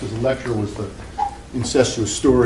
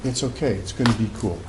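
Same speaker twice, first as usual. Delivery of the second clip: soothing reassurance; 8 dB quieter than usual. Retold or imitated. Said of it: "I go".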